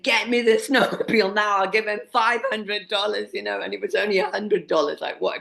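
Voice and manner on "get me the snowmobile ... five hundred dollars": a touch higher, lightly hammed-up bossy bargaining; mock demanding woman. Guest mocks her younger self ordering a snowmobile, lightly hammed-up. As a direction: replay her own pushy self with a wink. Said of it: "saw myself as that woman looking in the eye saying".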